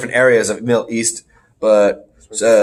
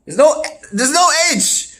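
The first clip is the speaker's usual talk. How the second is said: high-pitched, exasperated objection; incredulous protest; 13 semitones higher than usual. Real, not acted. Host disputing co-host's pronunciation, incredulous and high. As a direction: protest it in comic disbelief. Mock dispute over a name.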